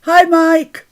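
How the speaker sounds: high, loud shouted hello; calling out loudly. Real, not acted. Streamer answering Mike waving from the kitchen, calling out loudly. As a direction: holler a greeting through to the next room.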